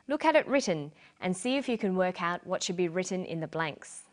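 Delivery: normal talking voice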